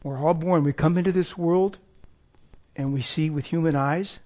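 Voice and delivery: ordinary delivery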